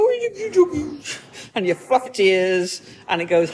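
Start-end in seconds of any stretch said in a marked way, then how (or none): 0.1-1.0 high cooing coo at a cute doggy; high-pitched doggy talk; baby talk to a dog. Retold or imitated. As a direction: gush over the puppy in a sing-song. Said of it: "you go up to a cute dog and you go".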